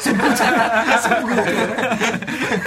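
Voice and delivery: laughing. Real, not acted. Correcting the word, laughing.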